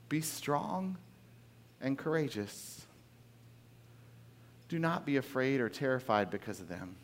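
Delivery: soft, steady encouragement; calm them gently, like a leader; quiet reassurance. Retold or imitated. Moses softly reassuring his fearful people. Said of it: "Moses turns to them and says".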